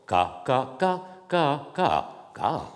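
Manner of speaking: KA repeated on stepped, contrasting pitches; contrasting tones. Shown as a demonstration. Lecturer letting listeners feel the difference between tones, stepped pitches. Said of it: "vous allez sentir la différence".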